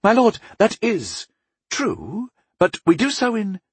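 very high-pitched abbess, hedging; high, halting objection; flustered protest. A narrator's reading. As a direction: concede it uneasily and start to object. Flustered abbess protests, gets cut off.

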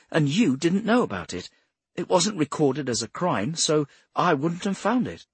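high-pitched, insistent explainer; earnest Bradshaw. Acted. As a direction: pitch it up and press the point.

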